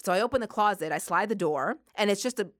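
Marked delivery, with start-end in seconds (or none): none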